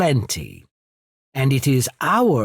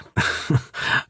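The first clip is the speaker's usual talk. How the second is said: brief, breathy laugh; short chuckle. Acted. Amused official chuckles before discussing the dukes, short laugh. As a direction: let out a quick little chuckle. Chuckle between the character's lines.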